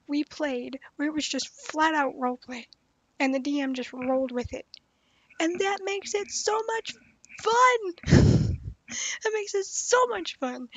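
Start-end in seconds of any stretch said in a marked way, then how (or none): 5.4-10.7 higher, louder gushing delight; thrilled, pitch leaping up; real excitement. Real, not acted. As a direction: burst out with how much you love it. Gushing about roleplay sessions.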